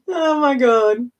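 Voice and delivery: slow, teary laugh through the words; can barely get it out for laughing; laughing helplessly. Real, not acted. Cracking up at the daddy long legs joke, slow and teary.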